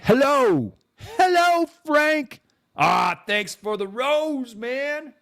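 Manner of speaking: high, slow, loud silly voice; mock voice a viewer doesn't really use; put-on voice for Frank. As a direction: greet him in a cartoonish high voice. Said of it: "he doesn't talk like this".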